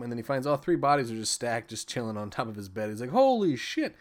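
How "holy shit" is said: high, loud, drawn-out exclamation; hammed-up reaction to a grisly find; mock shock. Acted reaction to finding bodies.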